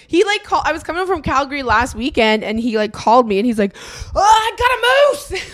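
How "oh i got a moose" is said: high, slow, thrilled cry of "I got a moose"; imitating a brother bursting with excitement; excited brother. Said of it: "he was so excited".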